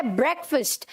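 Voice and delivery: ब्रेकफास्ट said "BREK-fust"; short vowels in both halves of ब्रेकफास्ट; correct pronunciation. Shown as a demonstration. Teacher modelling for students, ब्रेकफास्ट as "BREK-fust". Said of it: "आज से ये pronunciation है".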